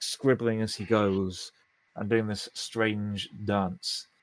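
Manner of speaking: ordinary delivery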